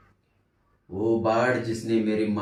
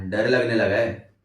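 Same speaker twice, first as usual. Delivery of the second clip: loud, contemptuous taunt; mocking roommate; 5 dB louder than usual. Roommate taunting the frightened narrator, mocking and loud. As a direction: jeer at his fear, pushing the volume up.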